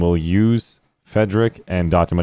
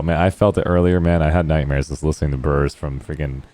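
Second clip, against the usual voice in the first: normal talking voice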